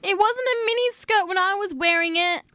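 high fast woman's voice; high girly voice. Acted. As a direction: squeak it out like a girl. Wendy played in high voice.

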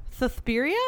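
"Suspiria" echoed with th sounds and a rise; mock lisp, questioning. Host echoes the lisped version back, skeptical. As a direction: repeat "Suspiria" with thuh-THPEER, doubting. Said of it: "Why you pronounce it like that".

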